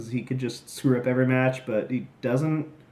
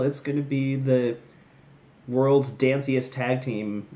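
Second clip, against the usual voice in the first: usual speaking voice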